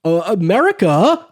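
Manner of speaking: ordinary delivery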